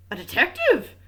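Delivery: astonished outcry; surprised exclamation. A narrator's reading. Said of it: "exclaimed Missus Renaud, very much surprised".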